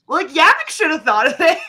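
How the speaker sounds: high, loud quote breaking into laughter; laughing retort. Retold or imitated. Board member joking about who should have thought of French, laughing retort.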